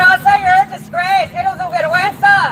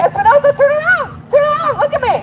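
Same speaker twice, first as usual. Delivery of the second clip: yelling at officer; 8 semitones higher than usual. Real, not acted. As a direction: call him out sharply.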